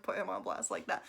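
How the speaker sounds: ordinary talk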